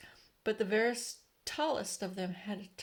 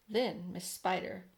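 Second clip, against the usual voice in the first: ordinary delivery, nothing marked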